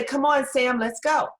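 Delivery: calling out. Retold or imitated. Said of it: "and you say".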